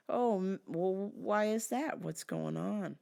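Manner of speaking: ordinary delivery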